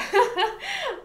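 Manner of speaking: high-pitched giggle at own joke; amused laugh. Real, not acted. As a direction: burst out laughing after your joke.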